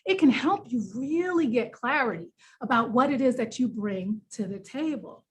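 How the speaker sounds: usual speaking voice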